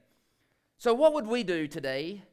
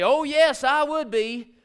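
loud, high mock boast; 8 semitones higher, 8 dB louder, slower than usual. Preacher mocking his own boast to the church, loud and high. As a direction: ham up a proud claim. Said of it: "boast and say".

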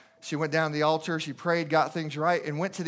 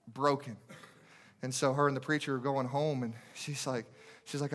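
ordinary delivery

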